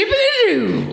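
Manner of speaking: high-pitched goofy nonsense catchphrase; Adam Sandler impression. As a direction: put on a high, silly comedian's voice. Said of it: "doing an Adam Sandler impression".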